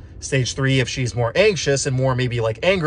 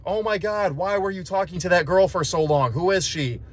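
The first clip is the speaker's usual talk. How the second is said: mock angry yell; 6 semitones higher than usual. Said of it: "She might yell at you".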